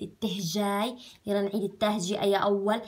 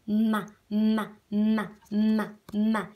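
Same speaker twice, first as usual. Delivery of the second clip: slow lip sound; slower than usual. Shown as a demonstration. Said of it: "الكل يعمل يلا هيك على تمه".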